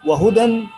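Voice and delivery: accusative-style tanween -an sounded on وهدى; double-fatha ending. Shown as a demonstration. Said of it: "بفتح، بالفتح، فتحتين".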